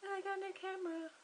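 high, giddy announcement; thrilled. Real, not acted. Telling viewers about her gift, giddy and high. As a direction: beam it out, still buzzing.

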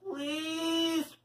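drawn-out high, soft plea; begging. A narrator's reading. Paul begs Leslie for one pigtail, high and pleading. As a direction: stretch it into a small wheedling beg. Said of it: "Paul begged".

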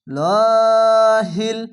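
লহিল chanted very long, loud, thick lam; slow recitation of লহিল with full lam; heavy lam. Shown as a demonstration. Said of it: "লহিল কেন পড়লাম".

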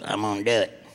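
low mock girl voice. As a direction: play the girl taking the bet.

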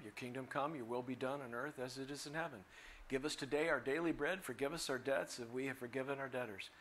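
hushed, unadorned reciting; recited prayer. Acted. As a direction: run quietly through a memorised prayer.

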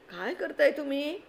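put-on a small boy puzzled by the banging; surprised child. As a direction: ask it wide-eyed like a small boy.

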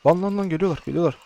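high frantic alarm; panicked yelling. Real, not acted. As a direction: shout in panic as they rush in. Enemies attacking in game. (panik içinde bağırarak)

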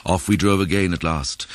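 usual speaking voice

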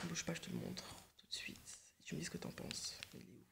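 murmure à voix basse, sans timbre; chuchoté. Real, not acted. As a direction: chuchote en cherchant sur le téléphone. Chuchote en cherchant la photo. (chuchotement en fouillant son téléphone)

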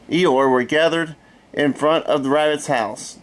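plain reading voice

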